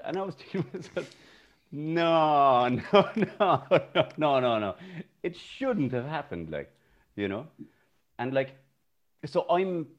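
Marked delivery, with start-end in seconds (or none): 1.7-7.5 तेज़, ज़ोरदार 'no no no' with a laugh breaking in; emphatic no. Retold or imitated. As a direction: shout down the idea, half laughing. Protesting the slap story aloud.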